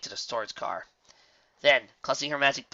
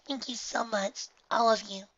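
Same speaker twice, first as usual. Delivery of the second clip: holding back tears; 11 semitones higher than usual. A narrator's reading. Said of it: "she managed".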